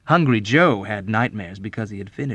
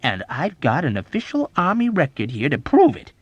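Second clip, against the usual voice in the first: loud, higher comeback; emphatic, argumentative comeback; sharp retort; 7 semitones higher, 6 dB louder than usual. A narrator's reading. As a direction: retort insistently. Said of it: "Major Sanderson retorted".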